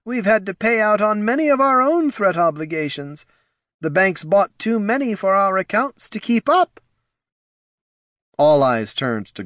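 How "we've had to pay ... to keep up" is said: nervous advisor voice. Acted. Reads Ortson's dialogue.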